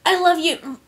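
high, hesitant declaration; Rainbow Dash. A narrator's reading. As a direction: confess it haltingly to a loved one. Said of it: "she hesitated".

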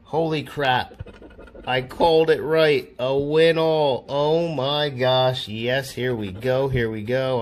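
loud, thrilled cheering; real loud excitement; excited. Real, not acted. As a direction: shout out the big win with joy. Scratch ticket win all.

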